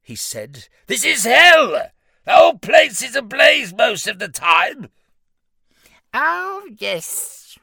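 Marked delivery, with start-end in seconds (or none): 0.9-4.9 loud, high indignant shout; outraged shout. Acted. Outraged demon protests to imp. 6.1-7.6 very high smug little imp; giggly sneering imp. Acted. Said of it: "replied the little imp".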